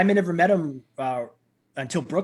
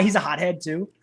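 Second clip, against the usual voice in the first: ordinary talk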